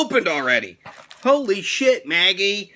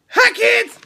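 much higher, louder cartoon wrestler greeting; high character greeting; 4 dB louder than usual. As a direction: belt out a cheery catchphrase way up high. Catchphrase greeting again.

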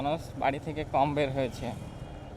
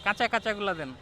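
calling out; 8 semitones higher than usual. Real, not acted. Bargaining loudly with vendor.

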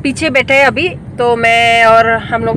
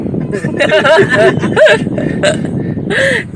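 high laughter; 8 semitones higher than usual. Real, not acted. Laughing at dad's jab.

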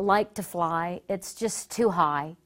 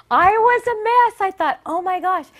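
high, loud outburst; reliving panic at full volume; worked-up, exclaimed dismay; frantic; 10 semitones higher, 10 dB louder than usual. Retold or imitated.

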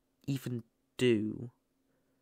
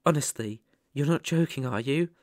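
usual speaking voice

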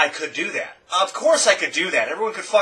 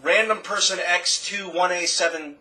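over-the-top YouTube intro parody; hyped YouTuber voice; 2 semitones higher, 11 dB louder than usual. Retold or imitated. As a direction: ham up a YouTuber reading his subscriber count. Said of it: "YouTube has developed this sound of".

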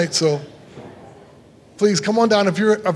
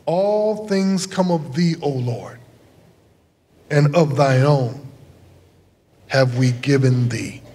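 prayer; 3 semitones lower than usual. Acted. Liturgical offertory prayer.

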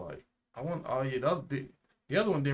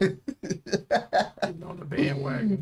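slow, high, laughing drawl; laughing slowly; 8 semitones higher, much slower than usual. Real, not acted. Mocking a character on the bandwagon, laughing.

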